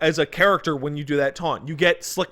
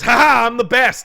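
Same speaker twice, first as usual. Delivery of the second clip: cocky boast; 8 semitones higher, 8 dB louder than usual. Retold or imitated. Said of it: "Dante goes".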